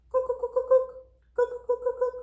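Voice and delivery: high, knocking woodpecker cry; woodpecker call. A narrator's reading. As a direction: peck out each cook like a woodpecker. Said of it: "Woodpecker calls from a tree".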